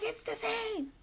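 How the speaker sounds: high, soft mimicking voice; high-pitched imitation of a stubborn friend; friend insisting. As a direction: quote her in a high, small voice. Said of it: "She's like".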